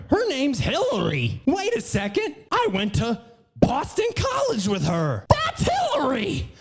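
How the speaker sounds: high-pitched indignant shouting, climbing to a squeal; mock indignant disbelief. Comedian voicing her former schoolmates, mock indignant disbelief. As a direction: build to a mock-outraged high yell.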